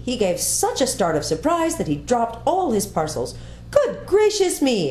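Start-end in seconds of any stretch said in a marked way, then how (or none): 3.7-4.9 much higher, slow startled cry; startled exclamation. A narrator's reading. The faun, startled at seeing the girl, gives a high, slow cry. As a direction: jump with surprise and draw it out. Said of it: "exclaimed the faun".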